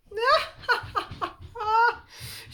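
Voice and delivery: high, loud crazed cackle; witch's cackle. A narrator's reading. Mum triumphing over Reggie, witchy cackle. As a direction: cackle wildly like a gloating witch. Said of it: "more like a witch's cackle".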